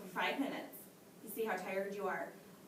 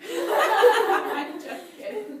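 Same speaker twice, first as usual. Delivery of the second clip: laughing; 10 semitones higher, 14 dB louder, much slower than usual. Real, not acted. Laughs while taking joke back.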